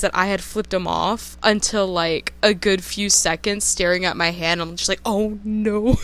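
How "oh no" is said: a laughing, sheepish "oh no". Retold or imitated. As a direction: laugh it out in embarrassment. Realizing she flipped him off.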